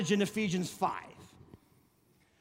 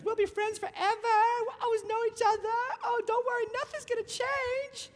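high, breezy promising voice; naive newlywed; 13 semitones higher than usual. Retold or imitated. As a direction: chirp it like a carefree newlywed. Newlywed promising friends forever.

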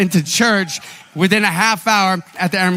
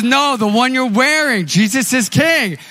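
excited; 8 semitones higher than usual. Retold or imitated. Clerk excitedly tells the pastor she loves his sweatshirt. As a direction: voice the clerk pointing out the sweatshirt with delight.

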